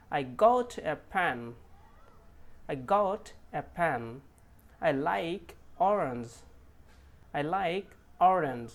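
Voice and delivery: each word slow, got and orange clear; slow sentence demo. Shown as a demonstration. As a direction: read it out slowly for learners. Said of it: "সেন্টেন্সে আমরা এটাকে দেখে নিই".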